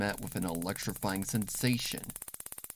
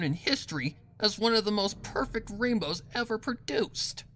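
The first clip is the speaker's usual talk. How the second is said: much higher, self-satisfied villain; Rainbow Dash, proud; 11 semitones higher, 4 dB louder than usual. Acted. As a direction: boast as if it were a gift. Dash boasts of her deed.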